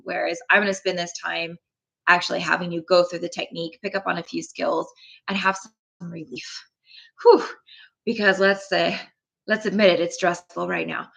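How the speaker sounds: usual speaking voice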